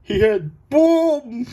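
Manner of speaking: high, loud, slow retelling of the guy; mock high quote. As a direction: squeak it out in a mock voice. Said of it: "He goes".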